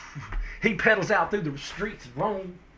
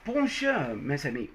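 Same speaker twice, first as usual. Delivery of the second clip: put-on French accent; 4 semitones lower, 3 dB quieter than usual. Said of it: "change my accent a bit".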